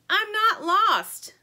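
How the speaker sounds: high, slow, tearful protest; sniffling. A narrator's reading. Anna sniffling to the chickens, teary denial. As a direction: sniffle through the words.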